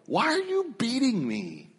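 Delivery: the donkey asks why it is beaten; pleading donkey. Acted. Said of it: "And the donkey says".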